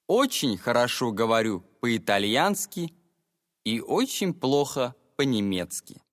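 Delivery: ordinary delivery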